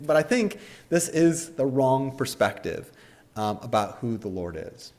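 normal talking voice

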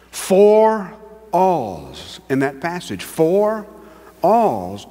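"Four" slow and punched, then "alls"; stress on four. Shown as a demonstration. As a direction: hammer the word "Four". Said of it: "But count the alls".

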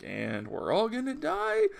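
high rising question, held at the end; mock alarm. Retelling Logan hearing everyone will die, mock alarm. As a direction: climb up high on a disbelieving question.

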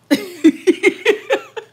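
a loud, high burst of laughter. Real, not acted. Laughing at viewers after teasing them, loud and high. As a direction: cackle out loud.

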